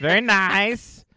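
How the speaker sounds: drawn-out, slow catchphrase impression; Borat. Riffing on an autocorrect joke, Borat impression. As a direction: stretch it out in a comic foreign character voice. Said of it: "Phones autocorrect to Borat".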